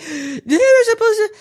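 high, loud mock whine of the upset teen; high-pitched imitation of a distraught daughter. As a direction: squeal it like the upset kid.

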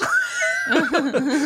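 high shrieking cackle; maniacal cackle. Real, not acted. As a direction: burst into a high, loud cackle. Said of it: "you went to, like, a maniacal cackle".

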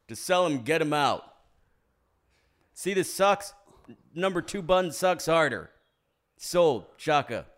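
loud, bossy salesman bark; The Rock impression. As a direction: order the buyer around. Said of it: "That's my impression".

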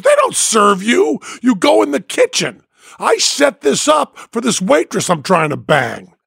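high, angry shout. Retold or imitated. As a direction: yell him down. Biker yells at Penn.

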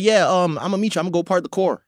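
lightly hammed-up LA accent; mock LA accent. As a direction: play up the LA accent, "core" for car. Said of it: "your A's are O's".

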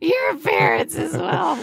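high, drawn-out mock repeat; mocking echo. Host echoes co-host's joke answer, mocking. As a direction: repeat it back high and stretched out.

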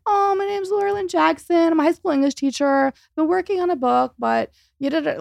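high, bright self-deprecating reply; downplay yourself, flustered; flustered introduction to the agent; modest, flustered. Retold or imitated. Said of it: "And I'm like".

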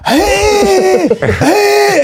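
high, strained gasping hey; gasping for breath. Retold or imitated. The actor hit in the elbow who couldn't breathe, gasping high. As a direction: squeeze it out as if winded. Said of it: "He goes".

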